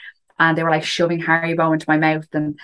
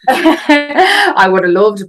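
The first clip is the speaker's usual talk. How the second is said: laughing through the words, louder and slower; 8 semitones higher, 8 dB louder, much slower than usual. Real, not acted. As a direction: chuckle while you say it. Laughing at the plane joke.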